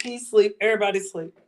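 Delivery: normal talking voice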